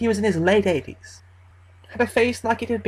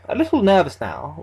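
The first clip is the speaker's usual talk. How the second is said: plain reading voice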